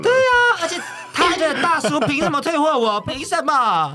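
rejected by the uncle, hammed-up indignation; mock indignant outburst. Real, not acted. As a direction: protest the rejection in mock outrage.

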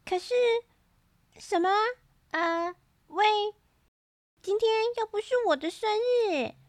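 put-on flustered child faltering between words; stammering. A narrator's reading. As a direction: stammer in broken starts, confused. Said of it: "波利结结巴巴地说".

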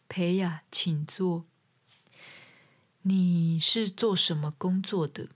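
hushed, slow doctor's question; imitating a very quiet old doctor, almost whispering; soft-spoken doctor. Quiet doctor greeting the patient and asking her job, hushed and slow. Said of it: "讲话很小声".

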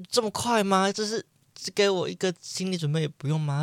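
high startled protest; can't believe it's so soon; alarmed disbelief. Retold or imitated. Retold reply to dentist, alarmed.

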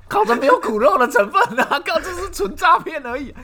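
high, loud laughing outburst. Real, not acted. Host cracking up at a friend's brazen loan story, laughing. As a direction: laugh through the protest. Reacting to absurd loan request.

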